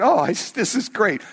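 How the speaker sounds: high, delighted mock gush; a light mock thrill at incense; excited quote. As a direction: squeal a little with joy. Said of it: "Some of you would be going".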